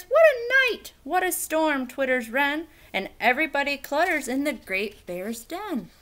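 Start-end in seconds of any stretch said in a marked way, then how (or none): 0.0-1.9 high, loud excited exclaim; mole exclaims. Acted. Mole arriving from the storm, loud high exclaim. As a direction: burst out about the storm. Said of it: "Mole mutters".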